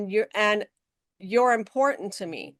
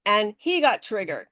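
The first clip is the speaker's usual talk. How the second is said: ordinary delivery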